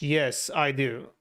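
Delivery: ordinary talk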